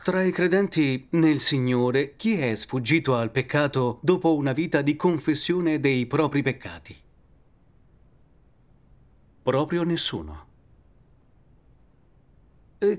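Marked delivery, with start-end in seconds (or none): none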